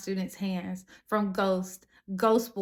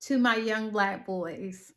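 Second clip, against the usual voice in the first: ordinary talk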